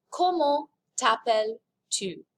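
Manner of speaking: "Comment t'appelles-tu?" spelled out slowly, word by word; slow model phrase. Shown as a demonstration. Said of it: "Here's how to ask".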